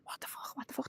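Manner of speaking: hushed panicked whisper; breathless horrified whisper at the sight; panicked retold whisper. Horrified guest at a ritual feast, whispering in panic. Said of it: "I started screaming".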